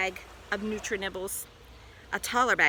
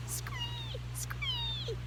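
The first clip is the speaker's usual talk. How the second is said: tiny, extremely high screech, kept soft; screaming frog; 7 dB quieter than usual. A narrator's reading. Frog bashing the bag to free his friend, screaming. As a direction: squeal a thin frog screech.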